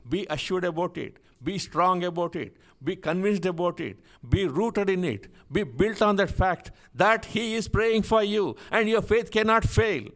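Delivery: preacher working up to full force; building preaching. Real, not acted. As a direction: drive each exhortation home, climbing as you go.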